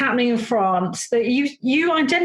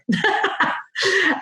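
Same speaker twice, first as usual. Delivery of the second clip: light laugh at her own French; self-mocking chuckle; amused laugh; 9 semitones higher than usual. Real, not acted. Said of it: "not so great".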